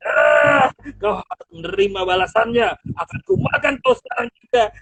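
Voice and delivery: loud, fast, threatening roar; roaring lion. Acted. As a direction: snarl it out, ready to eat him. Singa siap memakan tikus. (singa mengaum keras siap memangsa)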